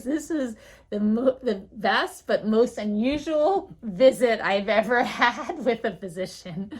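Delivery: loud, amused quote breaking into laughter; laughing verdict. Retold or imitated. Patient telling front desk nurse about visit, laughing. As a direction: exclaim it through laughs, imitating a delighted patient.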